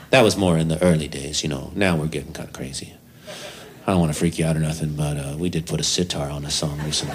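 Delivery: low, soft, hurried hedging; sheepish John. Retold or imitated. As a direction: play it down so she won't bolt.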